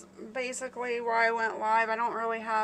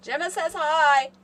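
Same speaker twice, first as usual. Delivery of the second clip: loud, higher-pitched call across the house; 7 semitones higher, 10 dB louder than usual. Real, not acted. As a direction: pass on a greeting to someone out of the room. Calling to someone walking away.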